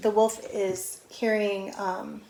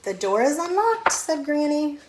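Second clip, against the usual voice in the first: Granny's voice; 7 semitones higher, 4 dB louder than usual. Acted. Granny voiced answering door.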